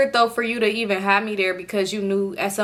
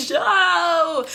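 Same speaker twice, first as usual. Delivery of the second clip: laughing plea; 9 semitones higher than usual. Retold or imitated. Pleading with boyfriend, laughing.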